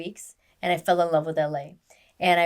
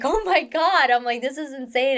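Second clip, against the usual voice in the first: higher-pitched retold amazement; excited disbelief; 7 semitones higher than usual. Retelling news that she joins the show, excited disbelief. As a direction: relive the thrill, pitch lifted. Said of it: "I remember I was so excited".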